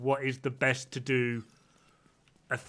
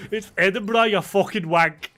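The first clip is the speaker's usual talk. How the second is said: louder, higher mock Scottish retort; Scottish accent; 5 semitones higher, 6 dB louder than usual. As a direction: Scotsman correcting the American, mock Scottish accent. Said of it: "the fucking Scotsman goes".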